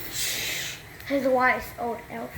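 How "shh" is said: breathy shushing whisper; a soft secret shh to keep the king quiet; hushing whisper. Acted. Elf hushing again.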